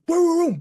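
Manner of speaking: loud, high barking "Boom, boom, boom"; imitated dog barks. Copying the dog Mika barking outside, loud and high. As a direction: bark it out like a startled dog. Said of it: "Mika goes".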